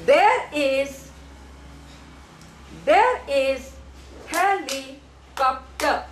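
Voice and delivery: "There is helicopter" slowly, with long gaps; syllable by syllable. Shown as a demonstration. Teacher wants child to pay attention, syllable by syllable. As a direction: model "There is helicopter" in separated beats. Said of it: "Pay attention".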